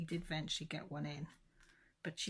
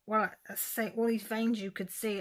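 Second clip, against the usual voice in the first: ordinary talk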